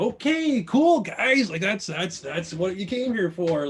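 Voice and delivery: high sarcastic mock brightness. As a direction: fake cheer covering the silence. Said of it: "I'm going".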